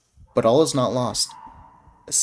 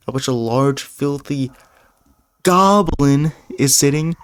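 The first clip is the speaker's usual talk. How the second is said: louder storyteller read; adventure narration; 5 dB louder than usual. A narrator's reading. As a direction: build the scene toward the goblin.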